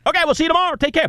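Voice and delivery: high-pitched cheery radio goodbye, mimicked; mock sign-off. Host re-enacting the end of that day's show, mock sign-off.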